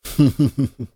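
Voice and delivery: a brief high laugh; short laugh. A narrator's reading. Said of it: "Холл засмеялся".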